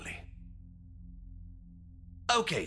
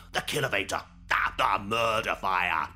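ordinary talk, nothing marked

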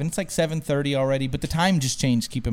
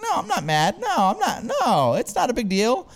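much higher mimicked voice; imitated woman's reassurance; 8 semitones higher than usual. Friend's girlfriend saying she isn't mad, imitated high and dismissive. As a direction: pitch it way up and brush it off.

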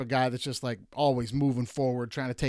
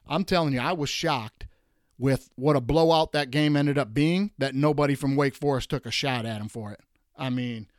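normal talking voice

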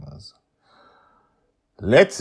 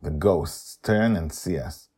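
plain reading voice

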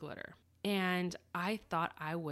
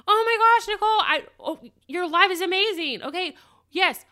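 much higher and louder, squealing delight; gushing excitement; 10 semitones higher, 11 dB louder than usual. Retold or imitated. Suzanne gushing to Nicole about her live, squealing and loud.

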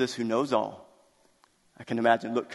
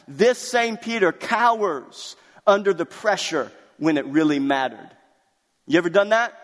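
preaching at full force; 6 semitones higher, 4 dB louder than usual. Acted. Preaches to the congregation.

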